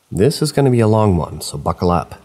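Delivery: usual speaking voice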